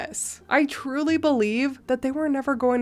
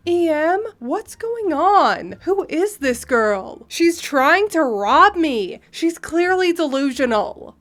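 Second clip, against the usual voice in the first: high, drawn-out voices of dismayed women; alarmed women arguing; 5 semitones higher than usual. Acted. Friend questions, mother accuses the girl, alarmed. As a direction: raise the pitch as a bewildered, accusing woman.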